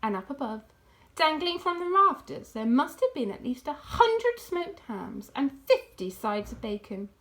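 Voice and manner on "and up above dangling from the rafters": ordinary delivery, nothing marked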